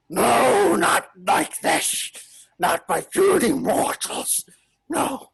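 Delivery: slow, strained villain refusing defeat; dying villain defiance. Acted. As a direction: hiss it out as he breaks. DM voices shattering villain.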